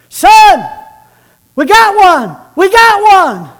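high, loud triumphant holler across the hills. Retold or imitated. Father calling down the valley to his son, loud holler. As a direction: call out to the son with hunter's joy. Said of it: "He hollers to his son".

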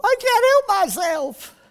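high, loud cry of helplessness; Paul despairing. Retold or imitated. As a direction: cry out that you can't help it. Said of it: "You can almost hear Paul".

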